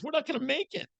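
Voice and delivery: a high desperate wife voice cracking with worry. Retold or imitated. Wife telling husband their marriage won't survive, high desperate plea. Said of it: "She goes".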